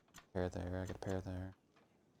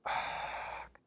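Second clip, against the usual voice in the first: long, voiceless sigh of frustration; exasperated sigh. Real, not acted. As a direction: let out a heavy, frustrated breath. Upset at own mistake.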